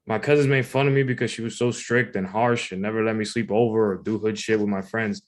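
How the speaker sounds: usual speaking voice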